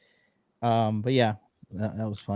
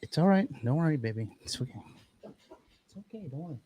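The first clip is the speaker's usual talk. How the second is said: soothing the dog; 3 semitones higher, 6 dB quieter than usual. Real, not acted. Owner calming dog after quake, coo fading to whisper.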